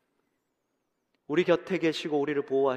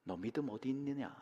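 low, quiet, piercing question; a hushed, searching rebuke; quiet stern question; 3 semitones lower, 11 dB quieter than usual. Acted. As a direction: ask it softly but gravely. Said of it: "더 무서운 질문을 하십니다".

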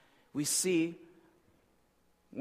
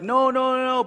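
higher, louder refusing; protesting refusal; 7 semitones higher, 6 dB louder than usual. Retold or imitated. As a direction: push back hard against the idea. Sailors refuse.

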